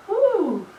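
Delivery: high, drawn-out "woo"; relieved workout whoop; exertion whoop. Real, not acted. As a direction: let out a high whoop as the set ends. Exertion after the last rep.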